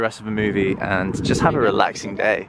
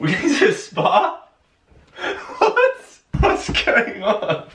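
shocked; slower than usual. Real, not acted. Overjoyed surprise.

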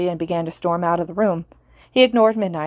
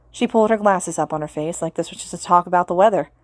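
usual speaking voice

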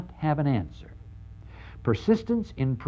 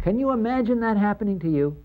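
higher and fast, disbelieving; incredulous challenge; 9 semitones higher, faster than usual. Real, not acted. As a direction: fire it at them in disbelief. Preacher's incredulous challenge to audience.

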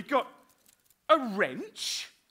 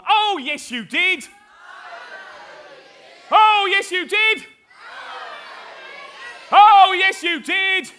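louder and louder panto shout; pantomime call; 5 semitones higher, 7 dB louder, slower than usual. Acted. [name] calling out panto-style to the children, growing louder. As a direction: egg the audience on, building each time. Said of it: "It's not pantomime time yet".

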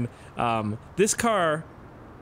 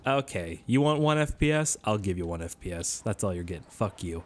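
low, dismissive mock retort; grudging game voice; 6 semitones lower than usual. The game answering the player, mock grudging. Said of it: "It's like".

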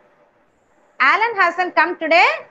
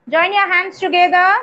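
ordinary delivery

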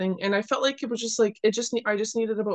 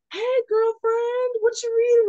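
much higher, louder chummy stranger voice; Atlanta garbage man; 13 semitones higher, 4 dB louder than usual. Retold or imitated. Atlanta garbage man calling over to a woman reading, high playful imitation. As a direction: imitate a forward stranger, pitched way up. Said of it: "this guy's behind me doing garbage".

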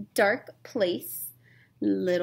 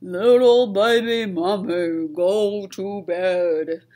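slow, louder swamp monster voice; a hint of a lumbering monster; Glob's warning; 7 dB louder, slower than usual. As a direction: warn gently but firmly, slowly. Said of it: "he said".

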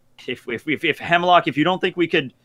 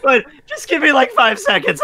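high, breathless giggling plea; real laughter in the voice; laughing; 9 semitones higher, 3 dB louder than usual. Real, not acted. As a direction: beg for a moment while cracking up. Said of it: "I'm sorry".

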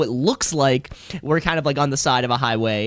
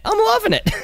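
squeaky repeat; 3 dB louder than usual. Acted. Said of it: "squealed echo".

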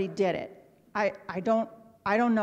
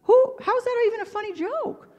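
very high, incredulous questioning; high-pitched, affronted bafflement; baffled indignation; 13 semitones higher, 3 dB louder than usual. Retold or imitated. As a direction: squeak out your disbelief at the joke. Indignant at being teased.

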